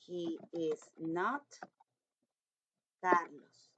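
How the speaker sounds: very slow, word by word; slow dictation. Shown as a demonstration. Teacher reading a model sentence slowly to the class. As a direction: spell it out slowly for young pupils. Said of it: "teacher reads model sentence".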